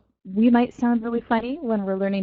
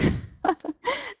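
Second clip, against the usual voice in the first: sudden high, soft laugh; 5 dB quieter than usual. Real, not acted. Teacher cracks up mid-lesson on accents, high giggle. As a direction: break off into a quick giggle.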